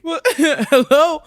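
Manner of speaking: slow, laughing hello on the phone; pretend phone answer.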